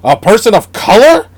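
hammed-up pearl-clutching disbelief; mock scandalised gasp. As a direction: gasp it like a shocked gossip. Mocking scandalised racist viewers.

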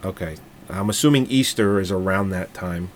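ordinary talk

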